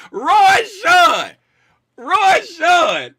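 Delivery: shouted name, high and loud; loud shout to the chat viewer; calling out loud. Real, not acted. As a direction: call out to him again.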